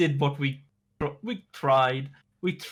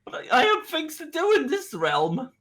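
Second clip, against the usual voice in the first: high-pitched objection; high, stammered protest; alarmed protest; 11 semitones higher than usual. Acted. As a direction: protest in a much higher voice. Character protests being sent away.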